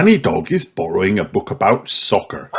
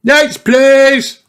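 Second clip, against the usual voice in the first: much higher, louder librarian call; Miss Rabbit calling out; 6 dB louder than usual. A narrator's reading. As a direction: call the next customer brightly. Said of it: "comes another shout".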